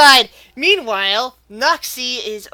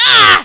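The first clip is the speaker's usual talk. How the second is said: loud, high acted grunt of strain; straining cry; 9 semitones higher, 14 dB louder than usual. Wrestler being pushed back, loud straining cry. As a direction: strain hard against a heavy push. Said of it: "and he's straining".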